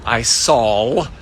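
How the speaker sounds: "saw" said as "sawl", with an L on the end; deliberate mispronunciation. Real, not acted. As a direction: add an L to "saw", drawn out slowly. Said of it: "continue to mispronounce the word S A W".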